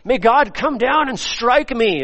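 a lightly voiced desperate oath; mock solemn oath. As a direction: call down heaven on yourself. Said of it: "summoning divine harm".

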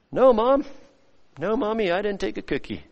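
a hint of a whiny child, trailing off softly; child caught lying. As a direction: play the guilty kid pleading with mom. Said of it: "like the child with the chocolate".

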